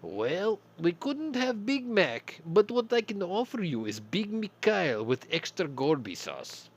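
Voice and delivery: put-on loud, high, slow hammy Russian accent; bad Russian accent. Soviet cashier pitches the Putin burger in a bad Russian accent. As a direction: oversell the specials in fake Russian. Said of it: "a really bad Russian accent".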